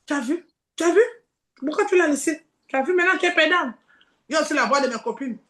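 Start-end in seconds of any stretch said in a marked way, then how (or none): 0.1-3.7 taunting Satan voice. Acted. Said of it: "Satan dit".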